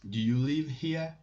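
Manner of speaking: "Do you live here?" said clearly as a model question; question intonation. Shown as a demonstration. French teacher translates the example question, question intonation. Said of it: "you will make your voice up".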